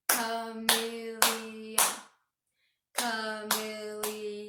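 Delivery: "Chameleon" chanted slowly in four even beats; "Chameleon" as a slow, level clap-along chant; clapped syllables. Shown as a demonstration. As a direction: stretch "Chameleon" out syllable by syllable on one note. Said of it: "clap it together just like this".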